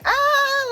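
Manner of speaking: very high, loud gasp of delight; delighted squeal. Retold or imitated. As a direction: squeal at your own reflection. Vicky admiring herself in mirror.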